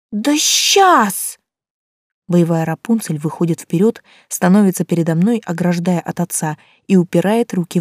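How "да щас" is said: put-on high, defiant child's retort; a feisty girl snapping back; defiant little girl. A narrator's reading.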